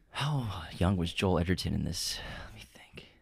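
hushed, barely audible musing; whispered thinking aloud. Real, not acted. Host puzzles over the release year, whispering. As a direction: murmur it to yourself while working it out.

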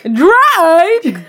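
high, loud, stretched disbelief; shocked echo. Real, not acted. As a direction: throw the name back, incredulous. Insisting in disbelief it is [name].

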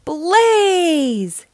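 high loud stretched rallying shout; battle shout. A narrator's reading. Blaze shouts as his wings glow with speed, loud and high. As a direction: yell it as you power up. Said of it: "he shouted".

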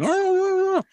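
high wordless moaning; imitated soap-gagged wail.

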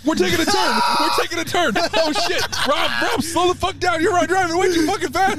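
high-pitched frantic passenger yelling; hammed-up panic. Panicking passenger as truck turns.

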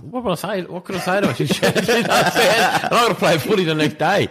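high, squeaky, through laughter; laughing. Real, not acted. Telling mates about mum being upset, laughing. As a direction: crack up while telling it.

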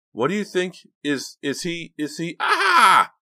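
high, breaking, halting fright; frightened stammer ending in a slow loud shriek; panicked. A narrator's reading. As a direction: stammer in fear and end in a shriek. Jade panicked, then startled.

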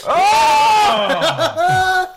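sudden high laughing yelp; real startled shout with a laugh; surprised shout. Real, not acted. Jenga tower falls.

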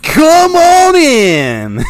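high, very slow, loud "come on down"; mock drawn-out pitchman. Imitating the dealer greeting a customer, drawn-out pitch. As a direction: stretch it out like a cheesy car dealer. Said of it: "He's like".

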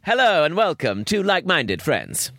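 big, slow welcome; show intro. Acted. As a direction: open the show with flair. Show opening greeting.

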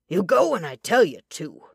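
flat-out command to the woman, no room to argue; bossy and blunt. Acted. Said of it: "he said bluntly".

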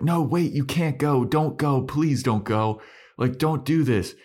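high, urgent mock plea; desperate pleading, acted out in a retelling. Acted plea to stop her leaving.

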